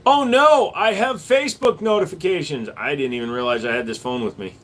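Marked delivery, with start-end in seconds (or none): 0.1-2.7 sudden high loud oh no; startled real dismay; surprised. Real, not acted. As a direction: caught off guard, voice jumps way up. Spots unexpected phone notifications.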